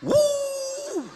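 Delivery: long, very high mock wail; hammed-up "wuuh" of sobbing. Preacher imitating a parent crying past the gate, mock wail. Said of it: "ah, nangis di situ".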